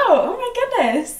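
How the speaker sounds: high, slow delighted exclaim; excited gasp. Real, not acted. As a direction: squeal it out with delight. Excited before heading out.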